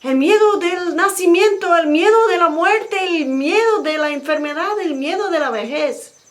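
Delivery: high, loud, fervent listing of fears; proclaiming shout. Acted. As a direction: cry it out while running. Nanda shouting while running.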